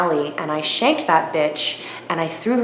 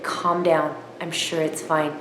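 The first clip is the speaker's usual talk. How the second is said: soothing reassurance; 3 semitones lower, 7 dB quieter than usual. Retold or imitated. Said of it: "He's like".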